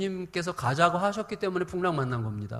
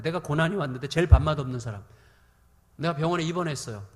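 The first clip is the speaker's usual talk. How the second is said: ordinary talk, nothing marked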